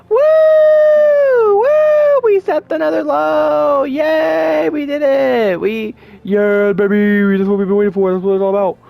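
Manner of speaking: high, loud sarcastic celebration; mock cheering. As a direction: whoop in high-pitched fake triumph. Mock celebration of lowered standards.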